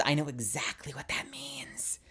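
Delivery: hushed whisper, voice dropped to breath; knowing whisper. Retold or imitated. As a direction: lean in and whisper it like a secret. Coworker's knowing reply.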